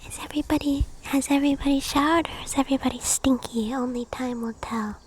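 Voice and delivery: soft unvoiced whisper close to the mic; gentle breathy whisper to viewers; ASMR whisper. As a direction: whisper it like a bedtime ritual.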